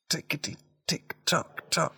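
high, sing-song countdown to the guess; ticking clock. Pretend. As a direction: tick off the seconds like a game-show clock. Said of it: "quiz timer countdown".

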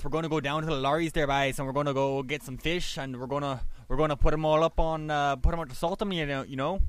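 quick Newfoundland accent. Shown as a demonstration. Showing friends his own people's accent, a quick Newfoundland accent. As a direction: rattle it off like a Newfoundland fisherman. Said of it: "it'd be a bit more like this".

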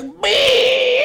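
a very high, loud startled yelp; panicked yelp. Retold or imitated. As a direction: shriek it like he almost got hit. Friend shouts in panic.

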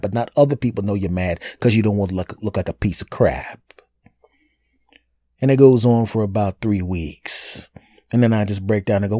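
ordinary talk, nothing marked

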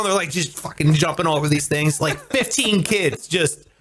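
ordinary talk, nothing marked

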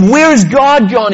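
high, loud, slow call. A narrator's reading. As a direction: call it out high and drawn out to a crowd. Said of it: "he called out".

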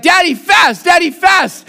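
high shrieking shout of "Daddy, fast!"; toddler shouting. Retold or imitated. Toddler son drags dad from the table, shouting. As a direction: yank dad up with the voice. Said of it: "but he's like".

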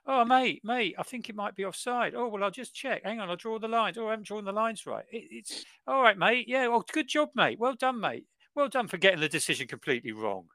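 high, mocking blokey voice; mock referees. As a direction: sneer at the bumbling referees in a high voice. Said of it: "These grown men going".

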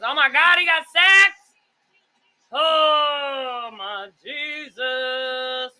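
high loud dismayed shout; shocked outcry. Real, not acted. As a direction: cry out at the sack. Reacting to quarterback getting sacked.

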